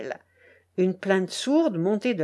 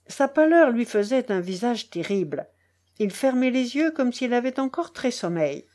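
ordinary delivery, nothing marked

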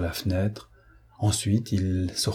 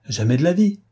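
louder, higher outburst of denial; exclaiming; 7 semitones higher, 4 dB louder than usual. A narrator's reading. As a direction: burst out with it. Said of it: "s'exclama Prasville". (Prasville s'exclame, plus fort et plus aigu)

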